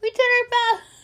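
high, loud exclamation; playful protest. Real, not acted. Playful protest after being teased.

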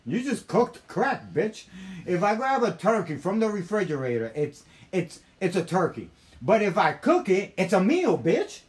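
loud, high comic indignation; exasperated argument. Retold or imitated. Proving to the woman it's crack, loud exasperation. Acted argument, turkey analogy.

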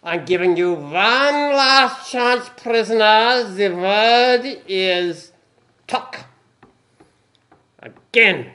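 loud furious German commandant; shouted German accent. Acted. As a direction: bellow slowly in German accent. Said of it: "the accent as well".

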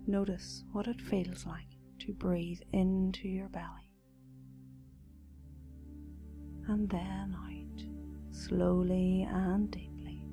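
soft, slow meditation voice; guided meditation. Acted. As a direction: guide them gently into calm.

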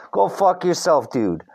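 usual speaking voice

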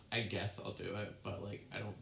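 low, soft, grudging indifference; understated sass. Acted. Sassy mailroom pelican grudgingly serving a customer, lightly voiced sass. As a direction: toss it off like you barely care. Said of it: "she just gives you sass".

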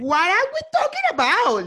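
loud, high, drawn-out English; mock outburst. Hurling English at someone from behind a mask, hammed-up. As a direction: throw it out slowly, big and high. Acts masked insult, running English catchphrase.

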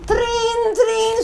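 bright imitated ring; alarm clock ring. Shown as a demonstration. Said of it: "Suena el despertador".

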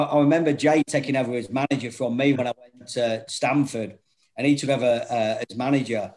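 normal talking voice

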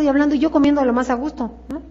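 ordinary talk, nothing marked

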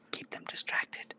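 soft, whispered repeat. Retold or imitated. Narrator repeating Squish's order to Goose, whisper.

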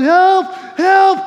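high, loud cry for rescue; panicked yelling for help. Acted. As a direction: yell as if a lion is closing in. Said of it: "started yelling".

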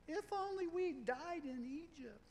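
high, drawn-out whine; put-on whiny complaining, stretched out high. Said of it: "That's my whiny voice".

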